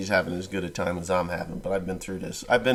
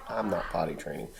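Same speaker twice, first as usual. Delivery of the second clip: normal talking voice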